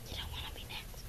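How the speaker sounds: soft, breathless warning whisper; creepy hushed warning. Shown as a demonstration. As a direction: whisper it as an eerie threat.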